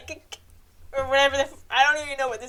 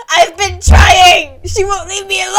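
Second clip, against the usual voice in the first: loud mock-sobbing yell; tearful shout; 3 semitones higher, 15 dB louder than usual. [name] yelling to Groven, tearful mock shout. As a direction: cry out defensively.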